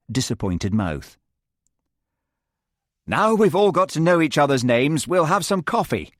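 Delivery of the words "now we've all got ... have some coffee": bright, higher and a bit louder; cheerful host moving things along. Acted. John cheerfully offering coffee to the class, bright. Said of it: "said John brightly".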